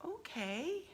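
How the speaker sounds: mock doubtful, not sure you like it; quiet and noncommittal, lifting into a question; imitating unsure reaction. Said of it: "I don't know if I like it".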